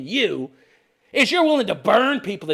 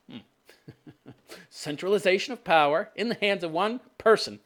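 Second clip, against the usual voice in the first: ordinary talk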